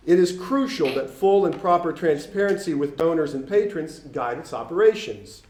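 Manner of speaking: ordinary talk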